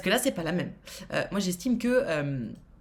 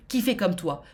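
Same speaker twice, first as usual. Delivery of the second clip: ordinary talk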